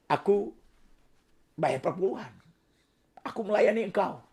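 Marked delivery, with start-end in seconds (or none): none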